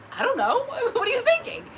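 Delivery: high, loud laughing comeback; laughing baffled retort. Acted. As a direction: throw it back laughing, voice shooting up. Acted baffled reply, laughing.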